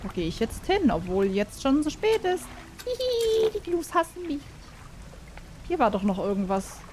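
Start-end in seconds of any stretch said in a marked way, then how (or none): 2.8-4.4 high-pitched giddy squeak; playful squeal. Real, not acted. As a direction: squeal it high, with a little titter. Playful squeal about the gnus.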